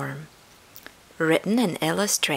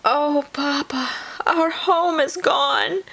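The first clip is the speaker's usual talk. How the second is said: put-on young possums crying out; 11 semitones higher than usual. A narrator's reading. Young possums to Papa about their lost home, crying out. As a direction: wail it like upset little animals. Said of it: "cried the young possums".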